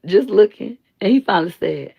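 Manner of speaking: ordinary delivery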